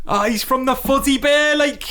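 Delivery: high, put-on Northern accent; mock Northern girl. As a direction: joke along in a squeaky Northern voice. Co-host mimics the Northern girls.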